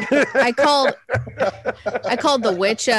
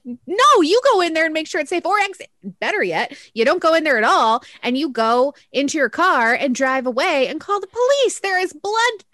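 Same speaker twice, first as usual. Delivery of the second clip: mock exasperated lecture; 6 semitones higher than usual. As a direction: scold the parents in disbelief. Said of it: "I'm like".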